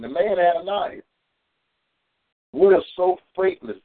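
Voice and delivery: ordinary talk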